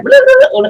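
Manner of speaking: high, loud rewinding babble; tape rewind noise. Acted. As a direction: imitate a tape spooling backwards. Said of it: "I do a rewind".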